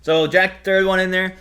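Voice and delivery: ordinary talk, nothing marked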